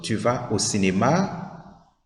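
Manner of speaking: "Tu vas au cinéma?" slow with a rising end on "cinéma"; question rise. Shown as a demonstration. Teacher repeats the question, rising slowly at the end. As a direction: lift the last word into a question. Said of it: "It is a question".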